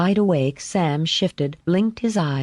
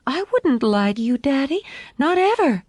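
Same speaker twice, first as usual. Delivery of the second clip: put-on high little-girl voice, earnest; solemn, slightly frightened child; 10 semitones higher than usual. A narrator's reading. Daughter assuring her father, solemn and anxious. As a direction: plead your honesty in a high voice. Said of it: "solemn and a little frightened".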